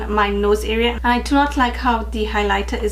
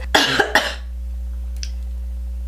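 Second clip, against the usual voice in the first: raspy dry cough; harsh cough; 9 semitones lower than usual. Real, not acted. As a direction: cough to clear a lost voice. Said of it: "my voice".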